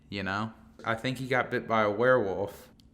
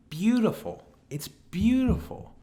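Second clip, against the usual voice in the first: much higher, ecstatic praise; delighted; 12 semitones higher, 5 dB louder than usual. Real, not acted. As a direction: gush over it, thrilled. Admiring his friend's reply.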